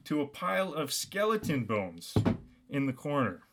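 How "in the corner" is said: usual speaking voice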